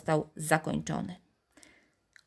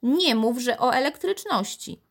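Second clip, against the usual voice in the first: high, raised voice of disbelief; higher-pitched grandmotherly challenge; exasperated grandmother; 7 semitones higher than usual. A narrator's reading. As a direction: scold from down the hallway. Grandmother challenges the boy from the corridor.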